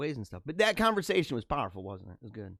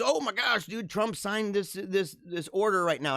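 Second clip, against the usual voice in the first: higher-pitched mock hype; mock excited announcement; 5 semitones higher than usual. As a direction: imitate an overexcited video host. Mocking breathless video announcements.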